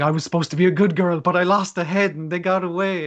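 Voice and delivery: hurried mock freak-out. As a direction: act out a flustered girl's panic, lightly. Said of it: "is kinda freaking out 'cause she's like".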